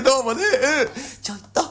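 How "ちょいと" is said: imagined wife's call. Pretend. Bachelor imagines his bride calling him, higher sweet wife voice. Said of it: "なんてね".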